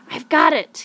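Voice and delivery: put-on boy thrilled at solving the triangle; excited discovery. A narrator's reading. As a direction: cry it out as the answer clicks. Boy solves the puzzle.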